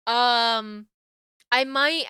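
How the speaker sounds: ordinary talk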